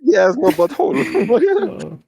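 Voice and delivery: high, slow, drawn-out voice; high slow mocking drawl. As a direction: stretch it out in a squeaky mock voice. Retelling movie line about Buck.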